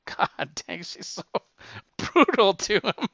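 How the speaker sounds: real laughter breaking up the words; laughing. Real, not acted. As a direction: squeeze the comment out through laughter. Host cracks up at the story.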